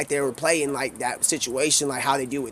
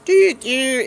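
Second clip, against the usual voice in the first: high, loud "doo, doo" jingle; bright little musical sting; sung jingle; 9 semitones higher, 5 dB louder than usual. Acted. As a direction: chirp it like a scene-change sting. Sound cue, transition to Christmas.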